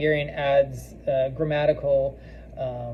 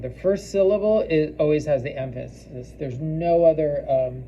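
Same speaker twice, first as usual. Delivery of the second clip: ordinary talk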